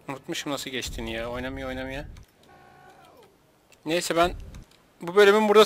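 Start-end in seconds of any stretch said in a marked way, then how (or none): none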